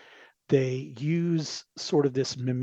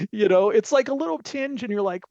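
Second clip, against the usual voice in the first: ordinary talk, nothing marked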